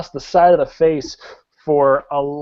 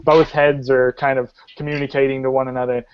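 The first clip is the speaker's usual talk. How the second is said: ordinary talk, nothing marked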